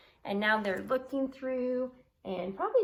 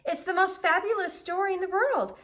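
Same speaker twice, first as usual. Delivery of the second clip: excited mermaid; 10 semitones higher, 5 dB louder than usual. Acted. Mermaid voiced excitedly in story.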